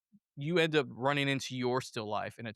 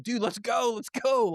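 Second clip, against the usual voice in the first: urging on; 9 semitones higher, 4 dB louder than usual. Acted. Acted partner urging him to run.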